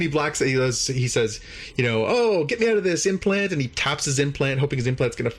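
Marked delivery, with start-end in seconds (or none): 2.1-3.5 high, pleading retold cry; mock desperation. Man begging to escape his implant, in high mock desperation. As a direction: plead in hammed-up distress. Retold plea while being mauled.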